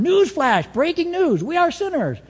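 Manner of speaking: high, urgent mock news announcer; hammed-up high-pitched bulletin; mock newsflash. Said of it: "That's not a news flash".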